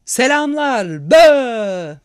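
slow, loud jeering greeting; mocking drunk soldier. A narrator's reading. Drunk soldier jeering at Henry and Edith. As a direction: leer and bellow at the trapped couple.

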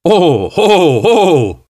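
high delighted exclamation; exuberant cry. A narrator's reading. Guest suddenly spotting a treat on the table, exuberant cry. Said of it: "diye bağırdı".

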